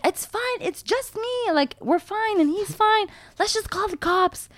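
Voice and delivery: very high retold insistence that all is well; high-pitched reassurance. Crash victim reassuring alarmed bystanders, very high voice.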